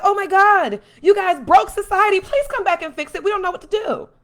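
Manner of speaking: high, loud, hammed-up begging; exaggerated helpless cry for rescue; mock desperate plea. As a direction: plead frantically, in mockery. Acts out public begging politicians.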